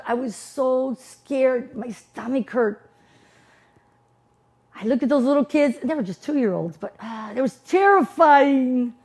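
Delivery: usual speaking voice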